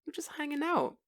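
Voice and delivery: high, soft whiny excuse; friend's high-pitched excuse about just hanging out. Retold or imitated. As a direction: whine it out like a guy dodging blame. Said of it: "'Cause he's like".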